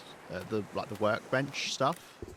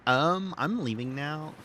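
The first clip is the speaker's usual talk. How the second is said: drawn-out, higher sassy American send-off; sassy American accent; 5 semitones higher, 4 dB louder, slower than usual. Pretend. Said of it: "did I do it in an American sassy accent".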